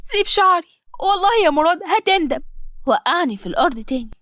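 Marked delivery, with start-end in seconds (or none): 0.0-2.4 pained defiance. Acted. Pulled by the hair.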